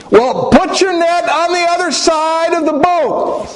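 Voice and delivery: very high, carrying call; high-pitched long-distance hail. Retold or imitated. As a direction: holler it across the water in a high voice. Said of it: "he called out, he said".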